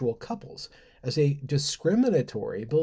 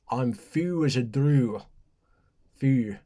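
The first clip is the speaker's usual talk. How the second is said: put-on slow, half-hearted Scottish accent try. Testing a Scottish accent on slang, mock Scottish. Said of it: "that's not enough for an accent".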